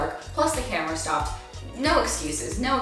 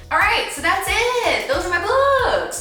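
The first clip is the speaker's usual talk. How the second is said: high, loud sing-song sign-off; big cheery high-pitched outro; excited wrap-up; 13 semitones higher, 6 dB louder than usual. Real, not acted. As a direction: call it out brightly, pitched way up.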